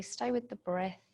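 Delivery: usual speaking voice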